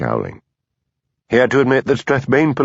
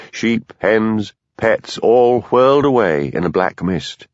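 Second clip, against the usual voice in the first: plain reading voice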